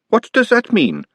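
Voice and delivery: a sharp, high snap of a question. A narrator's reading. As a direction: cut in sharply, suspicious. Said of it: "said Glenda sharply".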